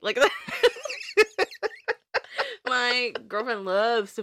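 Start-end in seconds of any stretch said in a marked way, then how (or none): 0.2-2.6 high wheezing laugh; wheezy laugh. Real, not acted. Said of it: "that's my real laugh when I'm wheezing".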